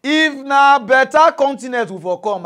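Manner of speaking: loud, slow, high call to the audience. Real, not acted. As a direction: shout it out, stretching the words. Ranting call to the women.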